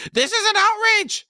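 high, loud outraged cry; outraged shout. A narrator's reading. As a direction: bellow it in outrage. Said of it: "Nethpara shouted".

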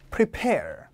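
"prepare" as pri-PAIR, falling on PAIR; stress on second syllable. Shown as a demonstration. Teacher adding a similar word, "prepare" stressed at the back. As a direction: lean on the back half of "prepare". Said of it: "얘도".

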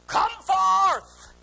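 loud, high drawn-out command; booming summons, stretched on the last word; commanding shout. Retold or imitated. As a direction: call the dead out with a shout. Jesus calling the dead Lazarus.

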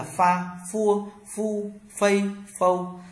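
"pha, phua, phu" each starting with an F; high level drill of "pha, phua, phu"; teacher chanting "pha, phua, phu" with clear F; F sound as in phở. Shown as a demonstration. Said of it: "F đọc giống phở phở".

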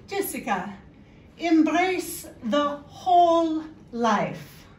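big, drawn-out, emphatic pronouncement; high and loud, stretch every word out slowly; mother-in-law quote. Retold or imitated. Mother-in-law advising her through covid, slow emphatic pronouncement. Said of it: "she would say to me".

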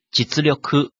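実力 read as Japanese "jitsuryoku", the 実 as "jitsu"; 実力 said the Japanese way, "jitsu-ryoku". Shown as a demonstration. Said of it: "一般读作基兹".

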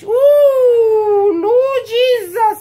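high, loud, drawn-out exclamation; stretched high wail of mock shock; mock astonishment. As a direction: imitate a crowd scandalized and delighted. Imitating children's reaction to her English.

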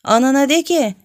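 startled urging. A narrator's reading. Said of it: "sıçrayıp".

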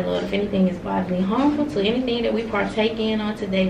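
soft, steady grace before meals; prayer. Acted. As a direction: pray over the food quietly. Blesses the food before eating.

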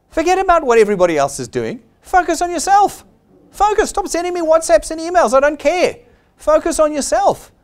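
high, loud repeated orders to focus; fed-up boss telling the team to stop complaining; emphatic scolding. Real, not acted. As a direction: hammer home focus, near shouting. Scolding the audience.

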